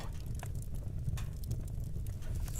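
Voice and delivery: a long, heavy exhale through the nose; weary drawn-out sigh. Acted. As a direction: let out the frustration in one breath. Tense argument, pausing to reset.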